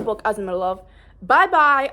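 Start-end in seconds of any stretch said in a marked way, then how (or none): 1.2-1.9 loud high playful bye-bye; cheerfully ditching other books; gleeful goodbye. Real, not acted.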